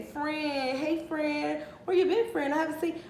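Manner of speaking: high, put-on over-friendly voice; mock friendly coworker. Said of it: "come up to him and say".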